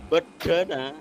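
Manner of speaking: "Bedana" loud and falling; Madurese articulation. Shown as a demonstration. Explaining the word for container, an emphatic falling "Bedana". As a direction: say "Bedana" emphatically as three syllables, be-da-na, dropping at the end. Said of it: "penekanannya, artikulasinya beda".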